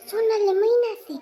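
loud, high shocked exclaim; shocked gasp. Acted. Eavesdropping woman, shocked at pregnancy news.

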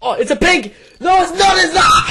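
high, loud disbelieving shout; excited shouting. Real, not acted. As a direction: yell at the near miss. Case opening near miss.

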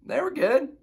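high, shrugging "good"; dad judging cheap tacos as so-so; meh verdict. Retold or imitated. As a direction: say good like it's just passable.

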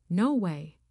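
firm refusal. A narrator's reading. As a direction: shut it down firmly. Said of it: "I said firmly".

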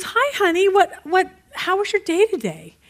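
high, sugary innocent greeting; mock-sweet wife, pitched high. Wife greets husband amid mayhem.

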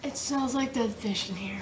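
hushed, breathy whisper. Real, not acted. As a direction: whisper it to the camera. Whispering about fish smell.

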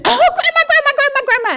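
high, gushing squeal of greeting; excited little grandchild. Acted. Little kid running in to grandma, excited. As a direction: call out in delighted, breathless repetition.

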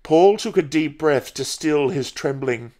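plain reading voice

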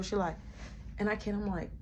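ordinary talk, nothing marked

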